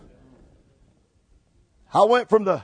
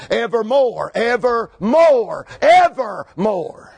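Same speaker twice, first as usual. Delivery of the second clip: preacher savouring ever more; emphatic repetition; slower than usual. Real, not acted. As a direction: stretch ever and more wider each time. Preacher hammering the key word.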